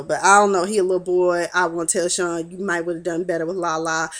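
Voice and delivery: usual speaking voice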